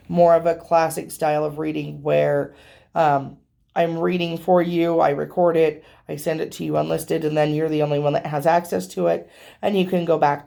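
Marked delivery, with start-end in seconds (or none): none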